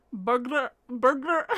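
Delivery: mock Scottish "burglar", said twice; imitated Scottish accent. Host imitates videos of Scottish people, mock Scottish accent. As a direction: mimic Scots struggling through "burglar". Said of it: "It's normally what it sounds like".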